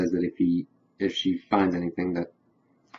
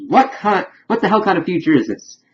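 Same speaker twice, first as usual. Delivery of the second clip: pitched way up, louder, in disbelief; incredulous outburst; 11 semitones higher, 5 dB louder than usual. Retold or imitated. Recapper voicing a baffled guy's shock at the future, high and loud. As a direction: blurt it out like you can't believe your ears.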